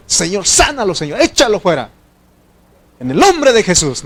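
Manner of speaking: high and loud, commanding the illness out; frantic shouted prayer. Retold or imitated. Preacher mimics a rushed healing prayer, loud and high. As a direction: cry out the prayer like a desperate healer. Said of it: "Porque uno antes, y viene algo".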